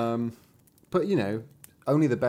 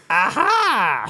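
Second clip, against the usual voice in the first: high, loud gloating villainess; mocking cry; 10 semitones higher, 8 dB louder than usual. A narrator's reading. Enchantress catching the king's son, mocking high cry. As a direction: crow it in triumph. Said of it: "she cried mockingly".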